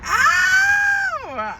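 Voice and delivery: sudden high yelp of pain; sharp cry of pain. Real, not acted. Sudden pain mid-rant.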